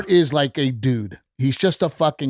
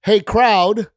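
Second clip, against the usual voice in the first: raised, louder stage call; calling out to the crowd; 5 semitones higher, 4 dB louder than usual. Retold or imitated. Comic to the club audience, calling out. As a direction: pitch it up to rally a room.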